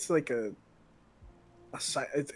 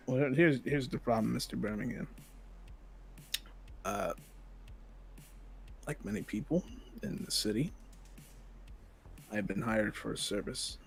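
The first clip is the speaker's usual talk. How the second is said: cold hitman; 3 semitones lower, 7 dB quieter than usual. Acted. Assassin speaks to victim.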